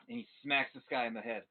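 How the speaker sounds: ordinary talk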